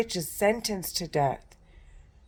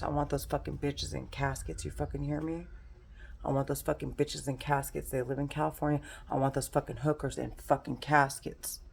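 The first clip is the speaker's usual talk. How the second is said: much softer, menacing threats; quiet seething; 3 semitones lower, 10 dB quieter than usual. Real, not acted. Threatening the women she blames, much softer and seething. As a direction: say it low and seething under the breath.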